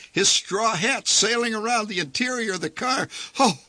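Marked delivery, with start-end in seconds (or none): 3.4-3.6 high, loud cry of alarm on a spinning ride; a dad losing his grip, yelling up high; panicked yell. Acted. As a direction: yell it as the hat flies off. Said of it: "he yelled".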